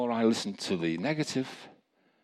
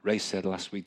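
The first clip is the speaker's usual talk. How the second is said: normal talking voice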